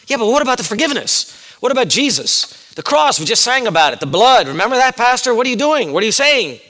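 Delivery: high, urgent flurry of objections; protesting congregation. Acted. Churchgoers interrupt the pastor about forgiveness, high-pitched protest. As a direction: play alarmed churchgoers challenging their pastor.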